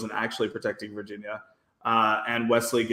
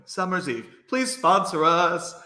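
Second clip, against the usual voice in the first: higher, drawn-out jokey appeal; mock sponsor plea; 6 semitones higher, slower than usual. Podcaster jokingly begging a brand to sponsor the show, mock plea. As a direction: plead slowly to the brand, tongue in cheek.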